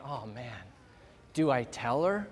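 almost to himself, dreading it; hushed private fret; inner worry. Retold or imitated. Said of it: "I'm thinking".

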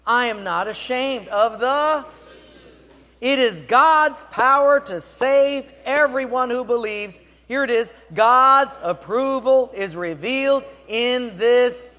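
loud, slow, emphatic congregational reading; leading unison reading. Real, not acted. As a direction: proclaim the verse high and loud, word by word. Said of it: "Read it with me".

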